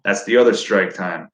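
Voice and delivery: usual speaking voice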